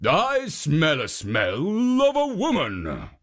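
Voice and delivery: lightly voiced slow ogre; a hint of a slow ogre; slow sniffing ogre. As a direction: savour the scent like a hungry ogre.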